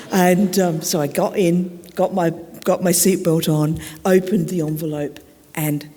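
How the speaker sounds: ordinary talk, nothing marked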